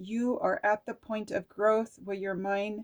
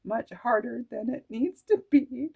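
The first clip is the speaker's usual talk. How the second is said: laughing; 4 semitones higher, 5 dB louder than usual. Real, not acted. Laughing while reading card.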